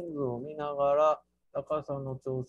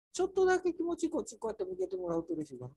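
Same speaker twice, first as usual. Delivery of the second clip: cutesy request; 14 semitones higher, much faster than usual. Pretend. Teacher asks in put-on voice.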